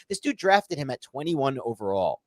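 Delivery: ordinary delivery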